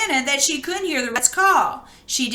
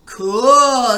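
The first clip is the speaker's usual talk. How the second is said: delighted cool; 7 dB louder than usual. Acted. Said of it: "said Bork".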